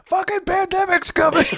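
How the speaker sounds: high, loud doomsday street shouter; mock screaming. Imagined warning to people on the corner, mock screaming. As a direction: scream it like a crank with a sign, half laughing. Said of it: "with the sign screaming".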